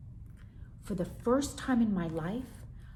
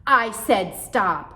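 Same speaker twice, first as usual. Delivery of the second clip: slow, much louder command; angry mother; 3 semitones higher, 12 dB louder, slower than usual. Acted. Mother slamming a spoon, demanding slowly and loudly. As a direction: lay down the law, slow. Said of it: "slamming a serving spoon".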